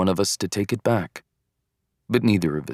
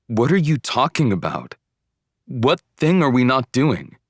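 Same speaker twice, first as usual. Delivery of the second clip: raised pitch, insistent questioning; incredulous; 5 semitones higher, 4 dB louder than usual. A narrator's reading. Boyfriend suddenly wide awake questions girlfriend on phone, incredulous. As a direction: demand an explanation in disbelief.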